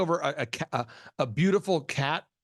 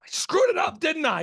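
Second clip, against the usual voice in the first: high, loud anxious question; panicked plea; 11 semitones higher, 3 dB louder than usual. Retold or imitated. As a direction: imitate the nervous DJ fishing for comfort. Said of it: "And he's like".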